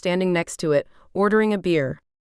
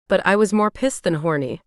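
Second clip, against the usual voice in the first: plain reading voice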